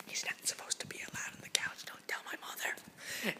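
secretive whisper; whispered confession, don't tell mom. Real, not acted. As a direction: let the viewer in on a secret. Secret about dog on couch.

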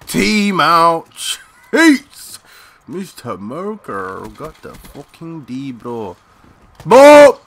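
loud, high hype with a wild yelp; real gamer hype, slow and loud; getting excited. Real, not acted. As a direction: let the excitement explode. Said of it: "I'm getting a bit excited here".

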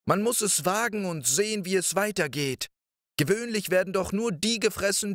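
put-on high, light little boy voice; Däumling speaking up bravely in a small high voice. (hohe Knabenstimme)

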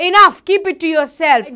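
loud, forceful acted rebuke; angry. Captain silencing the boy who questioned him, loud anger. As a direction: cut him off with an angry burst. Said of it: "Captain says angrily".

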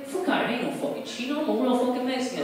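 high, fast, indignant homie voice; mock Mexican accent. Comic insisting he's no Chino, mock accent. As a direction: brush off the doubter. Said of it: "I say".

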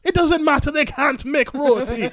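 mock indignant mother. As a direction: insist hotly, mimicking mum. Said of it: "She's like".

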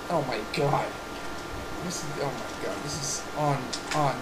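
very low, hushed disbelief; stunned murmur. Pretend. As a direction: trail away, lost for words. Stunned, at a loss for words.

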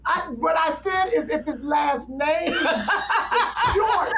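slow, laughing protest; laughing through it. Real, not acted. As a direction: explain it while cracking up. Laughing while explaining.

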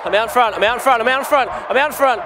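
excited cheering. Acted. Man cheering his bet down the straight, excited shouting. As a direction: cheer the horse home as if your money rides on it.

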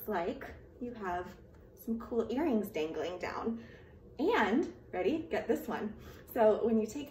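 muffled behind cloth mask; through a face mask. Real, not acted. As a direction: mouth covered while talking. Seen 0: Mask covers mouth and nose from about 1s through the labelled words.

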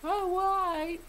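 high whiny imitation of a critic; mock whiny critic. Mocking a critic, high whiny voice. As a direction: squeak it like a complaining viewer.

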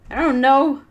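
loud, high frustrated outburst; exasperated. Real, not acted. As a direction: throw up your hands. Baffled at game response.

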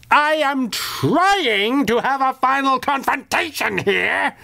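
high, slow, petulant villain's pout. A narrator's reading. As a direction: whine like a spoiled little menace denied his big scene. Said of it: "Kid Chaos pouted".

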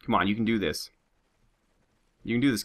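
ordinary delivery

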